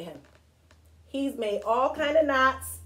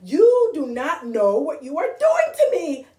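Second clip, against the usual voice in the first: high, loud, hammed-up outrage; exasperated shouting; 9 semitones higher, 9 dB louder, slower than usual. Grover begs the reader to stop turning, shouting. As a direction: yell it, building to a drawn-out high demand.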